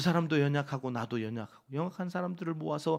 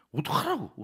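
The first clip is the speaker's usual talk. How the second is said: exasperated; 6 dB louder than usual. Retold or imitated. Retorting to his wife.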